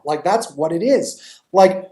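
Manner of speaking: ordinary talk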